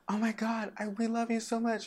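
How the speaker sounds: high-pitched imitation of an adoring lady; gushing fan. Lady at Sundance to actor, high gushing fan. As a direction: gush it in a very high voice.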